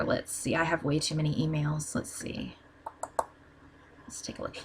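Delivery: very soft, half-whispered aside while searching; barely audible talking to yourself; muttering to self. Real, not acted. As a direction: trail down into a near-whisper, as if thinking aloud. Searching inbox, talking to herself.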